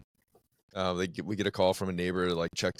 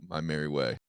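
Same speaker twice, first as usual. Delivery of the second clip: usual speaking voice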